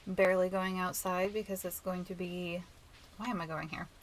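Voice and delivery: normal talking voice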